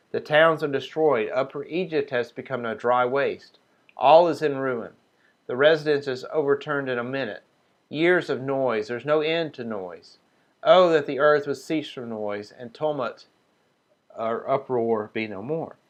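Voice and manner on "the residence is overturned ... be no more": normal talking voice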